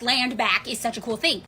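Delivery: usual speaking voice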